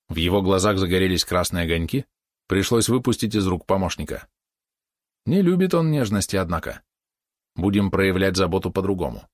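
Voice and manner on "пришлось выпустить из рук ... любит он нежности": ordinary delivery, nothing marked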